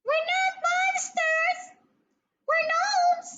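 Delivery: very high, squeaky little voice; tiny gnome voice. A narrator's reading. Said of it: "said another tiny voice".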